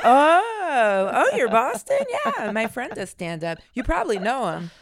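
high, bright-eyed imitation of a fan, laughing; lightly mocking voice of an eager stranger; mock chirpy fan. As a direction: play the clueless friend who knows a comic. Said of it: "all the time".

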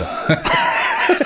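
high squealing laugh through the words. Real, not acted.

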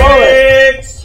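loud, slow, high call-out; a game master calling the check loudly; excited shout. Real, not acted. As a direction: shout the word out like a cheer.

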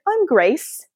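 high, put-on British accent; British Grace. Inner perfectionist gives her name, high British voice. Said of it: "British voice in my head".

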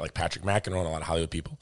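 normal talking voice

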